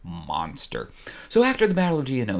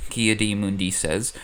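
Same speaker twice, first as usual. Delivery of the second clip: usual speaking voice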